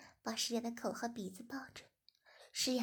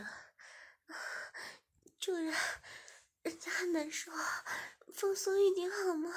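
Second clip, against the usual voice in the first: breathy gasp then higher pleading words; gasp, then beg him to ease off; panting; 7 semitones higher than usual. Acted. Shiya straining under the rope, gasping out a plea. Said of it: "诗雅边喘气边说".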